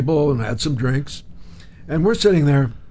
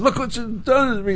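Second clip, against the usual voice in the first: high, hammed-up whimpering plea; mock whimper; 10 semitones higher than usual. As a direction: whimper it like a wronged invalid. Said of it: "and he whimpered".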